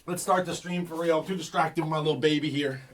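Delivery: normal talking voice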